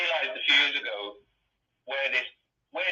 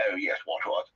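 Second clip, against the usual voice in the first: put-on posh accent; 7 semitones lower than usual. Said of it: "they're all talking like that".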